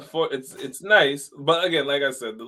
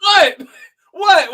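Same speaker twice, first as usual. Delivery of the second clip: shocked; 9 dB louder than usual. Real, not acted. Shocked by the [name] news.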